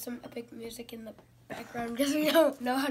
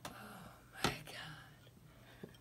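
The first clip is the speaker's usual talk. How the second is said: breathy disgusted whisper; 10 semitones lower, 17 dB quieter, much slower than usual. Real, not acted. Whispered reaction to taste.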